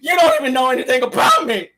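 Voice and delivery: mock [name] snapping at someone; imitating [name]. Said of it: "That's [name]".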